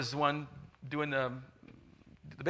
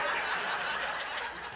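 breathless laugh; 6 dB quieter than usual. Real, not acted. Laugh after prayer quote.

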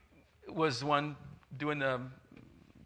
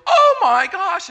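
high, loud, slow alarmed gasp; startled nurse; 13 dB louder, slower than usual. Retold or imitated. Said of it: "the nurse goes".

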